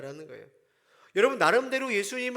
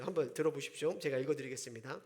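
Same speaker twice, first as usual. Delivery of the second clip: ordinary talk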